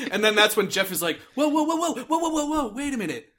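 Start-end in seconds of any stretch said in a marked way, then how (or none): 1.4-3.3 rapid higher-pitched string of protests; mock interjection. Jeff breaking into the quarrel, mock alarm. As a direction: cut in as Jeff, halting the quarrel lightly.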